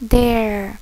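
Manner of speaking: "their" said slowly and clearly as "dair" for the class to copy; model pronunciation. Shown as a demonstration. Teacher models "their" so students repeat, a clear model word. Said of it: "chúng ta sẽ chuyển qua là từ their".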